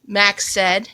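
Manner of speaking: put-on expressive read-aloud for a child; story narration. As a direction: read it like a bedtime picture book. Reading picture book to child.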